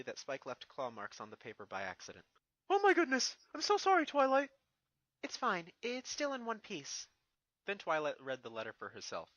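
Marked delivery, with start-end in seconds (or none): 2.7-4.5 high, loud dragon yelp of apology; panicked apology. Acted. Spike apologizes to Twilight for tearing the letter, loud and panicked. 5.2-7.1 higher, slower reassurance; calm him down gently; Twilight's voice. Acted. Twilight reassures Spike about the letter, higher and slower.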